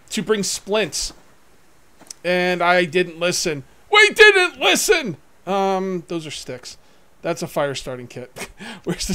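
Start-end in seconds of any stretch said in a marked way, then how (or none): 3.9-5.2 high, loud self-mocking wail; excited cry. Real, not acted. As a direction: cry out the blunder. Laughing at own mistake.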